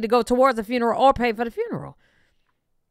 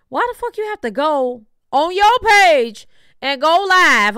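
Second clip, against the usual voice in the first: louder, higher, drawn-out indignant challenge; exasperated rant; 8 semitones higher, 7 dB louder, slower than usual. Real, not acted. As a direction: hammer each phrase out in loud exasperation.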